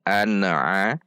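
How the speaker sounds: an-a with N bouncing into a tiny extra vowel; bounced N. Shown as a demonstration. Teacher shows wrong reading, an-a bounced. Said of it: "Tidak boleh dipantul".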